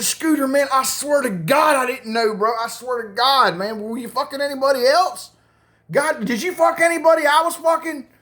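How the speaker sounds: high-pitched frantic pleading; nervous panic. Retold or imitated. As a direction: swear innocence in rising panic. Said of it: "I was so fucking nervous".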